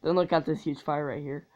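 normal talking voice

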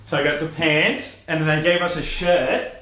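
usual speaking voice

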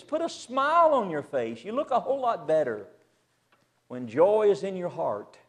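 ordinary delivery

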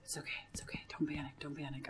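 hushed, nervous muttering to yourself; panicky self-soothing. Real, not acted. Player calming themself as monsters come out, panicky hush. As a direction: talk yourself down under your breath. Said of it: "I'm totally panicking".

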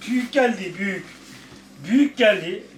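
pet talk. Real, not acted. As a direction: tell the dog it's too big, high and cooing. Talking to dog about outfit.

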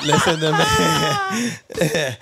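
laughing through the words; can't stop laughing. Real, not acted. Laughing at own joke.

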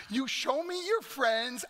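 normal talking voice

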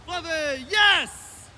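high shout from a distance; far off shout. Real, not acted. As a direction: send the dog from far off. Distant command to dog.

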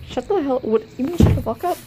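ordinary talk

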